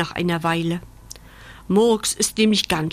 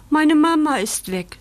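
sobbing; 8 semitones higher, 4 dB louder than usual. Acted. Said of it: "schluchzt Bandit".

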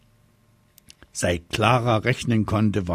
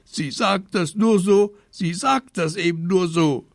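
put-on high, loud, tearful child's complaint; hammed-up upset little boy; whining protest; 10 semitones higher, 5 dB louder than usual. A narrator's reading. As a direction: wail that nobody should believe her. Boy protests to mother, still upset.

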